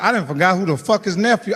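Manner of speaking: ordinary talk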